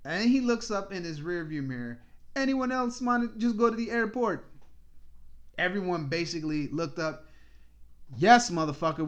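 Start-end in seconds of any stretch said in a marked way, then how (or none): 2.3-4.4 higher, louder driver voice; lightly voiced driver asking around; imitating the driver. Driver asking through rearview mirror. 8.2-9.1 loud fed-up yes; exasperated answer. Retold or imitated. Passengers answer the driver.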